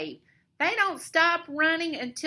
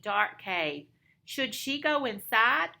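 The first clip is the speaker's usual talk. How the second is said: ordinary delivery, nothing marked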